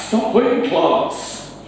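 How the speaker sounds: Hoyt class said high, slow and breathy in Geordie; a PE teacher calling Hoyt class to the lads in Geordie; Geordie teacher's call. Retold or imitated. PE teacher telling the lads off, in Geordie. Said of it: "Our P E teacher says".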